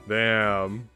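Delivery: ordinary talk, nothing marked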